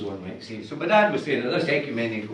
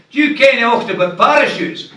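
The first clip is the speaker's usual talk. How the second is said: high, loud call while falling; shouting mid-air; 11 semitones higher, 10 dB louder than usual. Acted. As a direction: shout it across the sky to the stranger.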